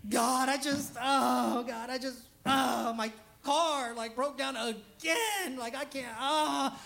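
hammed-up anguished plea to God; begging prayer. Shown as a demonstration. Showing how not to pray, mock begging. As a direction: drag out the mock desperate groans. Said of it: "you don't do this".

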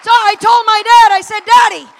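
loud, high, triumphant shouting; full-force preaching. Real, not acted. Preacher telling how dad snapped off the priestly thread, shouted preaching. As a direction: proclaim it at full voice.

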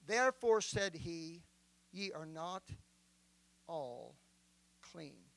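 measured, solemn Bible reading; solemn King James reading; scripture reading. Acted. Reads John 13 aloud.

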